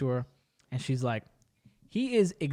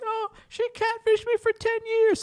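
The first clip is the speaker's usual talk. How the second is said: much higher, wailing through tears; crying wail. Retold or imitated. A catfished woman crying to her best friend, high wailing. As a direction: wail it out sobbing. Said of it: "And she's like".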